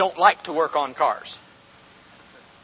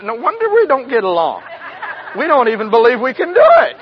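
laughing; 6 semitones higher, 7 dB louder than usual. Real, not acted. Preacher laughing at his flock.

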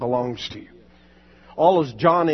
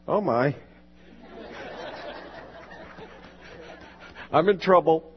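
chuckling nervous aside; laughing through it; 4 semitones higher, 4 dB quieter than usual. Real, not acted. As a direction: laugh while admitting trouble. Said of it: "in my head I'm going".

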